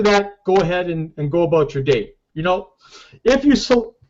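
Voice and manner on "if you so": interrupted by a hiccup; talking with the hiccups. Real, not acted. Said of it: "excuse me, I got the, the hiccups".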